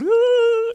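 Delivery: very high, loud mock wail; child's shrill bawl, put on; imitated bawling. Scared child left in car, mock bawling. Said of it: "she's bawling".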